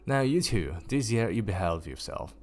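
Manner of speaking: plain reading voice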